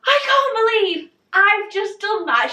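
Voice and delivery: high-pitched, amazed squeal; amazed disbelief. Retold or imitated. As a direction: re-enact giddy disbelief. Retells disbelief after lift.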